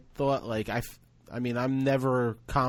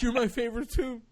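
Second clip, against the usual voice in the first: high, mock-weepy squeal; overcome with love; 11 semitones higher than usual. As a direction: gush back like a doting uncle falling apart. Overcome uncle answers his niece.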